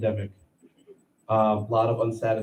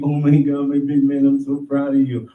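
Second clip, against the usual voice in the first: put-on louder gushing praise for a toddler; mock proud parent; 9 dB louder than usual. Parent cheering a kid's first steps, mock gushing. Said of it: "you're like".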